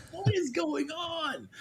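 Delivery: high, drawn-out mock outcry; exasperated disbelief. Parent finding wrappers in the couch cushions, exasperated disbelief. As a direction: stretch it out in high-pitched exasperation. Retold reaction to stuffed granola wrappers.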